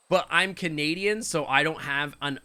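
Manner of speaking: normal talking voice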